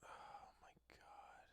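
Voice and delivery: very soft, slow whisper; stunned whisper. Real, not acted. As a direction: breathe it out slowly, stunned. Stunned at rankings.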